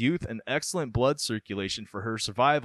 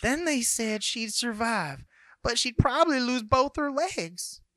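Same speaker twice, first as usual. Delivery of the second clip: mother impression; 12 semitones higher than usual. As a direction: plead it like a fretful mom. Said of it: "I hope that's a spot-on impression".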